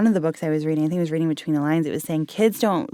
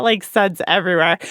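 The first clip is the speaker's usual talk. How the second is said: usual speaking voice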